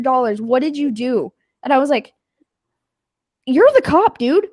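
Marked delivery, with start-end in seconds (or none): 3.5-4.5 higher, louder, incredulous jab; arguing with the clueless cop, exasperated; exasperated retort. Retold or imitated. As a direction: throw it back at him in disbelief.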